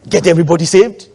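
ordinary talk, nothing marked

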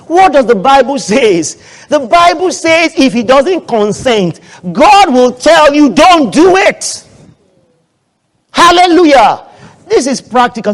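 loud, high, drawn-out sermon climax; full-force preaching. Real, not acted. Preacher telling church what the Bible says, full-force preaching. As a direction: proclaim it slowly at the top of your voice.

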